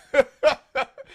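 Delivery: real loud laugh. Real, not acted. Laughing at viewer's mug joke.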